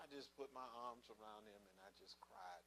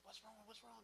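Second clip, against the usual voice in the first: alarmed question; 11 semitones higher, 8 dB quieter than usual. Retold or imitated. Husband hears weeping at door.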